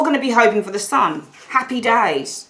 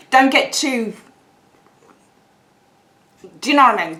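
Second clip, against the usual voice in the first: normal talking voice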